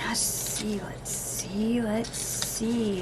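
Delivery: hushed anticipation. Real, not acted.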